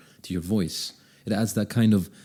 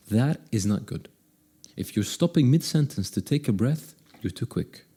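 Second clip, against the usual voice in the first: ordinary talk, nothing marked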